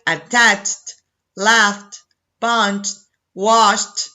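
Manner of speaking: ATTACHED through WALKED, each closing on a crisp T without an extra syllable; word-by-word model list, a T ending on ATTACHED, LAUGHED and the rest to WALKED. Shown as a demonstration. Teacher modelling unvoiced sound verbs, ATTACHED to WALKED each ending in T. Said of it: "the pronunciation of E D is T".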